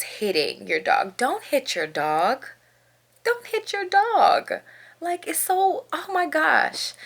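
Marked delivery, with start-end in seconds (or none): 1.2-2.5 slow, deliberate plea with a rising end; measured, drawn-out instruction; slow warning. Real, not acted. Repeats plea, first take low. 3.2-4.5 very high, slow, drawn-out plea; exasperated high repeat for emphasis; high-pitched plea. Real, not acted. Repeats plea, far higher. 5.0-6.9 high-pitched dismay; exasperated. Real, not acted. Exasperated at people hitting dogs.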